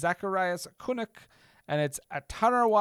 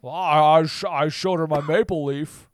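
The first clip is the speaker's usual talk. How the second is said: Jimmy Stewart; 6 dB louder than usual. Pretend. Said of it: "Jimmy Stewart?".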